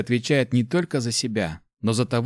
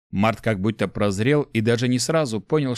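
ordinary delivery, nothing marked

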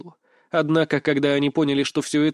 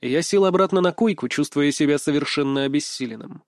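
plain reading voice

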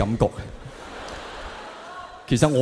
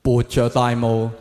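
mock-earnest lyric recital to pass a gang test; reciting song lyrics as poetry; 6 semitones lower, 7 dB quieter than usual. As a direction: intone each phrase like a solemn poem. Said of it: "你要識背啲詩".

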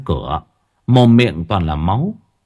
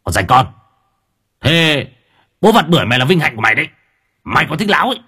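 snarling; 4 semitones higher, faster than usual. Acted. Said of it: "giọng nạt nộ".

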